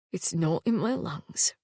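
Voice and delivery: slow, soft and unbothered; calm. A narrator's reading. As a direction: play it down, slow and steady. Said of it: "said Sarkis calmly".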